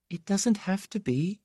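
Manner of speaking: put-on thin, high young-lady voice. A narrator's reading. Young woman answering her old friend's farewell, thin voice. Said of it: "she said in that thin voice".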